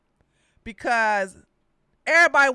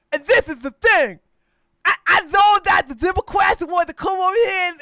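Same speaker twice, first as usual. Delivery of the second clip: loud, high campaign-rally rant; loud, high mock stump speech; Herschel Walker impression; 6 semitones higher, 9 dB louder than usual. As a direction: ham up a fired-up candidate speech. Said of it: "It was Herschel Dan Walker".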